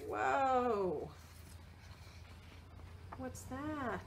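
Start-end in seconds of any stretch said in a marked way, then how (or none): none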